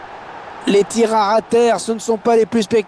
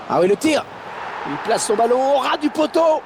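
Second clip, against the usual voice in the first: excited shot call; 9 semitones higher than usual. Real, not acted. Shot and goal.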